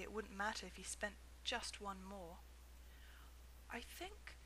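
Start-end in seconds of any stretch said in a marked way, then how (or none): 3.7-4.4 soft and hesitant, higher; a shy boy working up courage; quiet hesitant boy. A narrator's reading. Boy quietly asking the shopkeeper, soft and hesitant. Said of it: "he said quietly".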